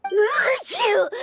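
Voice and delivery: a drawn-out, forceful sneeze; big sneeze. Acted. Paimon's allergy getting worse, big sneeze. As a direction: sneeze hard as it gets worse. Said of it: "Why did Paimon start sneezing".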